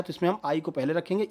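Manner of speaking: ordinary delivery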